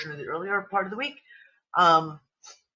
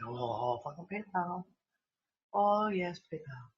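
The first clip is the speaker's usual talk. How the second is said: muttered PayPal grumble; 8 dB quieter than usual. Retold or imitated. Retelling her PayPal troubles to the chat, a quiet fed-up mutter. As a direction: grumble it under your breath in mock disgust. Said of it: "And I'm like".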